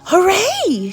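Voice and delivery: high, loud cheer; excited shout. Acted. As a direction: cheer out in delight. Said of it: "shouted George".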